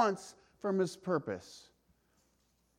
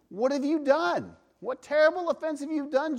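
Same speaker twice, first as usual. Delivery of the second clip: exasperated Pilate; 7 semitones higher, 4 dB louder than usual. Acted. Pilate questions Jesus, voiced.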